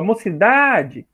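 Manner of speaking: "Mocidade" called out with a long, stressed DA; calling at the gate. Shown as a demonstration. Teacher demonstrating how to find the stress, "Mocidade" called with drawn-out DA. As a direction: call "Mocidade" slowly, stretching DA. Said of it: "chama a palavra lá no portão".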